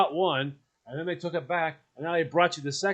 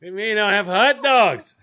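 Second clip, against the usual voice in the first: playful; 10 semitones higher, 7 dB louder than usual. Real, not acted. Joking about hot dog pizza.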